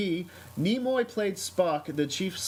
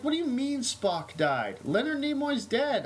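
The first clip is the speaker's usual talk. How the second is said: shocked disbelief; 9 semitones higher than usual. Retold or imitated. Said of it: "I'm like".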